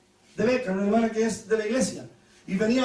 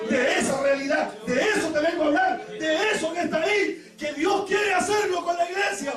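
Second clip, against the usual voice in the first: higher, slower and building; rising preaching; 5 semitones higher than usual. Real, not acted. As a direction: preach it out, climbing. (predicación que sube con fuerza)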